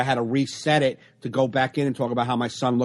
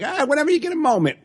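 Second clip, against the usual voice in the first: polite mock voice; 13 semitones higher, faster than usual.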